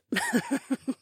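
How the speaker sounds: soft, high female chuckle; quiet, amused outlaw laugh. A narrator's reading. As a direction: chuckle lightly to yourself. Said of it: "The green-haired woman chuckled".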